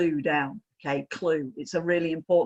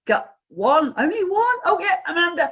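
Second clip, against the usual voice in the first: high, louder surprised check; real surprise; 11 semitones higher, 5 dB louder than usual. Real, not acted. Trainer finding one hand up on gallery view, surprised. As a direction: pitch leaps up on spotting someone. Spots a single raised hand on screen.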